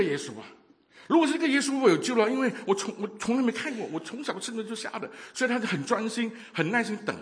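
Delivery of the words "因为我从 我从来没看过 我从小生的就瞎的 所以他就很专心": normal talking voice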